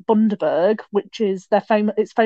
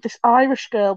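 usual speaking voice

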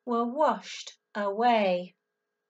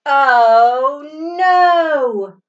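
loud, alarmed oh no; dramatic oh no; 12 dB louder than usual. Acted. Storyteller cries out as Pete steps in strawberries. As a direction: gasp it out to the kids.

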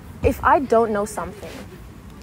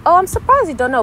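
higher, louder happy surprise; 9 semitones higher, 8 dB louder, faster than usual. Shown as a demonstration. Showing a kinder way to voice surprise, happy tone. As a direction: let the surprise lift your voice cheerfully. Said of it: "it's like, it's a happy tone".

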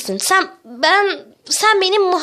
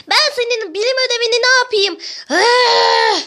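high, exasperated retort; squabbling protest with raised pitch; indignant quarrel; 6 semitones higher than usual. Acted. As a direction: argue back hotly, ending in a cry. Acted quarrel over spoiled homework.

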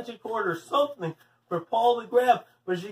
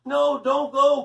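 higher, louder frightened plea; crying out; 5 semitones higher, 5 dB louder, slower than usual. A narrator's reading. Paul begs Leslie not to leave, crying out. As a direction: call her back in alarm. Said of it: "cried Paul".

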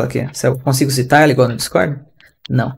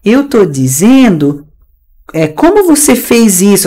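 higher, drawn-out mock teacher voice; imitating teacher; 5 semitones higher, slower than usual. Teacher translating for her class, slow mock imitation. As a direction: mimic a teacher slowly explaining a translation. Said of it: "Aí ela falou".

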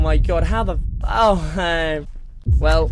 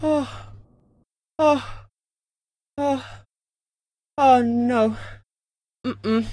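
scared murmurs; 7 dB quieter, slower than usual. Real, not acted. Creeping, scared, very quiet.

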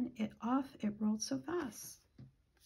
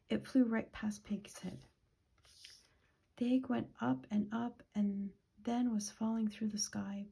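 plain reading voice

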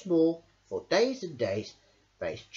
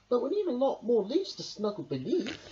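Hedgehog voice; 10 semitones higher than usual. A narrator's reading. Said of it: "cried Hedgehog".

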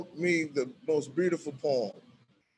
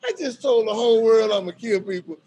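higher, louder exasperated complaint; exasperated inmate; 5 semitones higher, 5 dB louder than usual. Retold or imitated. Eric scolding the narrator for greeting him, exasperated. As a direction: scold him, half laughing. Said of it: "he said".

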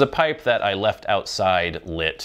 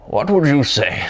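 higher-pitched put-on old wizard voice; mock old wizard questioning; 6 semitones higher, 4 dB louder than usual. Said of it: "like an old wizard voice".

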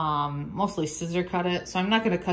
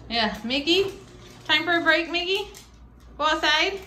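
higher, sing-song pet voice; 9 semitones higher than usual. Real, not acted. Owner asking her dog about going outside, in a higher, coaxing pet voice. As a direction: coax him with a bright lilt.

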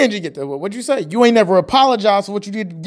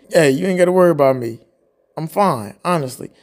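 ordinary delivery

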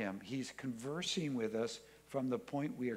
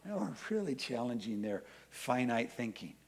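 ordinary delivery

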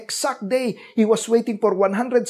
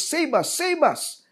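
high, rising plea for rescue; pleading cry; 8 semitones higher, slower than usual. Retold or imitated. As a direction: beg as a desperate crowd. Crowd's plea explained.

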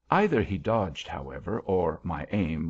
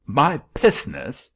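put-on loud, higher German-accented outburst; indignant exclamation; 6 semitones higher, 8 dB louder, slower than usual. A narrator's reading. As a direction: burst out offended, slowly, German accent. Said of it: "ejaculated the thing".

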